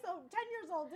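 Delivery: ordinary delivery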